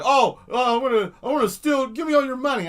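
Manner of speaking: robber voice. Acted.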